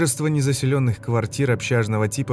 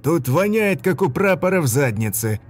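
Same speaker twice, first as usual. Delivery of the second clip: disgusted complaint; 6 semitones higher, 3 dB louder than usual. Acted. Soldier complaining about stench.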